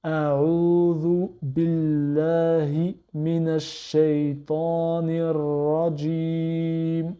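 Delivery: slow, careful Arabic recitation; every syllable drawn out. Shown as a demonstration. Teacher reciting the phrase slowly for learners, slow and careful. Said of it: "je vais dire la phrase lentement".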